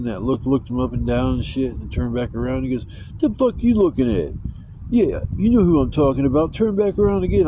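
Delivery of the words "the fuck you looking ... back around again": higher-pitched imitation of an angry rider; acted belligerent challenge, pitched up; pissed off. Bus rider challenging him for looking, pissed-off imitation. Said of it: "all pissed off about something".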